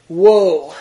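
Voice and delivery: loud, drawn-out prophet's woe; teacher declaiming woe to the class; proclaimed woe. Shown as a demonstration. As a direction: boom it like a prophet. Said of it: "As in W O E".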